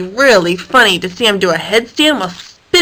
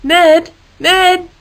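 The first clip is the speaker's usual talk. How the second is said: higher calling; 8 semitones higher, 3 dB louder than usual. A narrator's reading. Harold calling up to Ned the nuthatch. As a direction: call up to the wire. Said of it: "Harold called out to him".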